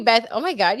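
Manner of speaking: normal talking voice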